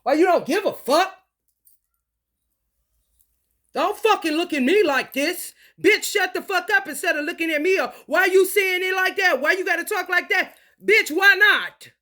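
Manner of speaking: high, heated comeback; angry retort. Real, not acted. Answering critics of how the speaker talks, angry retort. Snapping back at imagined critics.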